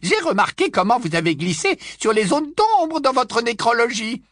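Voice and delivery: put-on high, loud old busybody voice; high loud tipsy old aunt gushing; old aunt Muriel.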